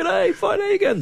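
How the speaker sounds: high-pitched gushing hello; excited greeting. Retold or imitated. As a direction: gush at the dog in a squeaky voice. Owner greeting excited dog.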